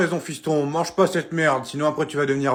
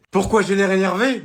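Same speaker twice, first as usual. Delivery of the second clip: louder, higher angry repeat; angry echo; 6 semitones higher, 5 dB louder than usual. Acted. Mum scolds her son for the broken figurine, angry. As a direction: throw his question back at him, furious.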